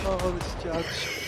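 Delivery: slow, drawn-out mock dismay; imitating a scared, upset groan. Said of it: "You sound so upset about that".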